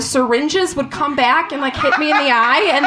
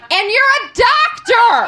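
high, drawn-out indignant yell; hammed-up outrage at the doctor; outraged retort; 10 semitones higher, 4 dB louder than usual. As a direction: exclaim it loud and slow, appalled. Acted outrage at doctor's answer.

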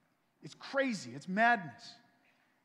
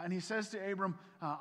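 usual speaking voice

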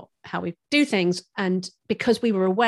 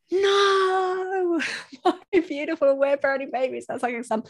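mock dismayed wail; 9 semitones higher than usual. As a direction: wail in hammed-up horror, breaking into laughter. Retells own dismay at thumb sucking.

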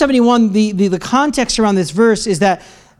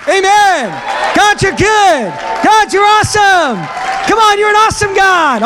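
shout of triumph; 9 semitones higher than usual. Real, not acted. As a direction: roar out praise to God. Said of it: "Come on, give a shout".

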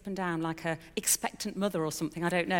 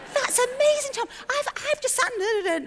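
much higher and louder, delighted squeal; thrilled exclamation; 7 dB louder than usual. Retold or imitated. Wife hearing husband had the same call, thrilled exclamation. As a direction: gush over the coincidence. Retelling her delighted reply.

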